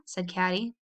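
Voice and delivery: ordinary delivery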